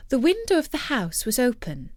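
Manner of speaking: ordinary delivery, nothing marked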